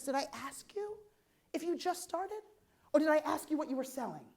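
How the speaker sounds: mock retort. Speaker to an apologetic business starter, mock challenging. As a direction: pick at the excuse with high, quiet questions.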